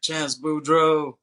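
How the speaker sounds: drawn-out, hammed-up self-introduction; name announcement. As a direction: belt out the name slowly, in a mock-grand way. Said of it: "the guy says his name".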